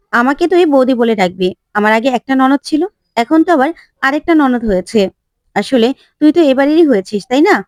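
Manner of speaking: ordinary delivery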